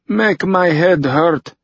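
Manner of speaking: put-on high, slow Russian-accented complaint; broken English, Russian accent. A narrator's reading. Hungover Fyodor complains to Tucker, in Russian-accented broken English. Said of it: "in badly broken English".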